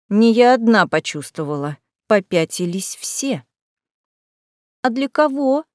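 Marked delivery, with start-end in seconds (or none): 4.8-5.6 small, higher, cautious voice; timid squeak. A narrator's reading. Lupin asks from behind the narrator's back, timidly squeaking. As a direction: squeak it out from hiding. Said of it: "тихо пискнул мгновенно спрятавшийся за мою спину Люпин".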